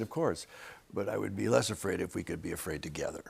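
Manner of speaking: soft, low, hushed confession; choked up. Retold or imitated. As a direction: retell it tenderly, nearly whispering. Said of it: "I get choked up".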